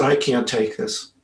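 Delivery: overwhelmed sister-in-law refusing the gift, tearful. Retold or imitated. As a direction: barely get it out through tears. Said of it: "But then tears".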